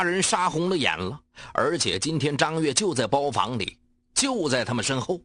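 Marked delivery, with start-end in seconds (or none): none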